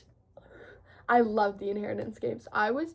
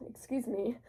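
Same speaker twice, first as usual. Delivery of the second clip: whispered excuse me; 7 dB quieter than usual. Real, not acted. Quiet aside after sniffle.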